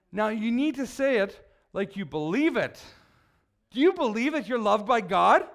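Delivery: ordinary delivery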